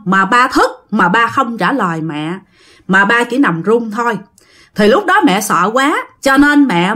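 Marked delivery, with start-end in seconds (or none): none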